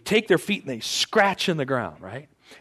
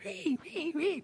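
high thin screeching wee wee; piercing bird call done in a high squeak; eagle cry imitation; 10 dB quieter, slower than usual. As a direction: mimic a hawk's cry, high and soft. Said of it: "You can hear 'em".

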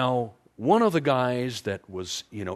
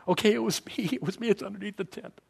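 much higher, giggling guilty admission; sheepish confession; 10 semitones higher, faster than usual. Retold or imitated. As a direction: squeak out the confession like a caught thief. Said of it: "Achan's standing there with his family going".

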